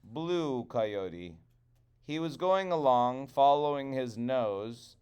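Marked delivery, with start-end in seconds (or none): none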